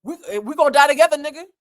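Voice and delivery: imitating Suge's frantic threat, fast and loud; mock threat. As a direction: bark it out like a cornered man. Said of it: "Suge is moving around the room like".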